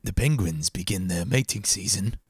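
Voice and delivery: lightly voiced hushed documentary narration; nature documentary narrator. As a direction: narrate like a wildlife film. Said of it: "I sound like a nature documentary guy".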